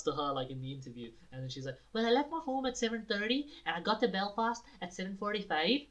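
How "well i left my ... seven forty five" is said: high-pitched Irish accent impression; lilting mock Irish voice; kooky Irish girl. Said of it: "this really kooky Irish girl".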